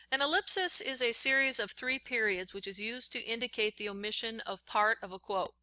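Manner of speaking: ordinary talk, nothing marked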